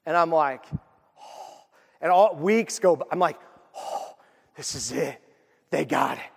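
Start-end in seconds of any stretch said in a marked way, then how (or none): 1.2-1.6 awestruck whispered "oh"; hushed awe. Retold or imitated. Kid spotting the big present, hushed awe. 3.8-6.3 hushed awe. Retold or imitated. Retells awe at big present.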